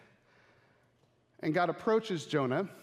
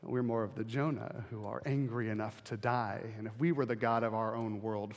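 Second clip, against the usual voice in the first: ordinary delivery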